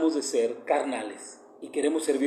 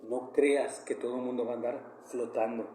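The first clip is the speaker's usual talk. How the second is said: soft, lower voice of a loving mother; a hint of his late mother's soft voice; mother's tender advice; 6 semitones lower, 9 dB quieter than usual. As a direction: pass on a mother's gentle warning, hushed. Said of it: "Así me lo dijo mi madre".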